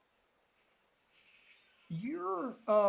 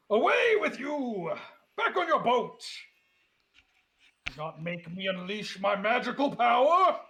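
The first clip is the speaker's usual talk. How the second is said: blustering crab threat; 4 semitones higher, 4 dB louder than usual. Acted. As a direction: bluff like a scared crab trying to look big.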